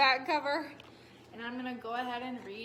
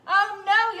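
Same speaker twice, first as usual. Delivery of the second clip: loud, higher cry of alarm; yelled; 8 semitones higher, 13 dB louder than usual. Acted. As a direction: shout it as the box goes under. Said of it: "yelled the farmer".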